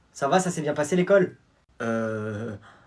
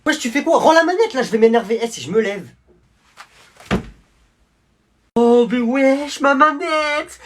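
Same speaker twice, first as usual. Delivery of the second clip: furious, high, loud yelling; angry shout; 9 semitones higher, 8 dB louder than usual. Acted. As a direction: scream at him to give it back. Brother grabs his game controller.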